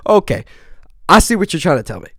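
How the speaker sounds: high, loud, fast and amazed; excited realization. Real, not acted. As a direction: blurt it out in stunned excitement. Realizing God is speaking.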